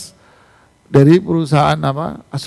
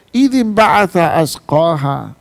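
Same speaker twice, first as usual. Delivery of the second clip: Quran recitation; 3 dB louder, slower than usual. Acted.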